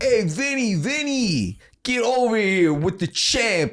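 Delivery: high-pitched New Jersey shout-out, hammed up; New Jersey accent. Jersey local calling Vinny over, hammed-up New Jersey accent. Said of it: "They talk with their hands".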